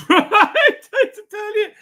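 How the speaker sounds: high, laughter-filled words; laughing. Real, not acted. Streamer replying to viewer, laughing. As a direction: talk through a burst of laughter.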